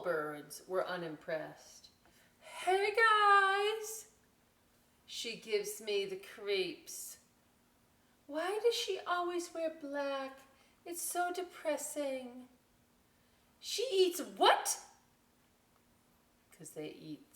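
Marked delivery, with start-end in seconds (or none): 2.4-4.1 high, loud cheery bird call; chirpy cool bird. Acted. Cool bird calling out to the vulture, high and loud. 8.3-12.5 lightly voiced high gossiping bird; snooty cool bird. Cool birds gossiping about Vulture. 13.6-14.8 high, loud, slow disbelief; shocked cool bird. Acted. Cool bird appalled at what the vulture eats, high and loud.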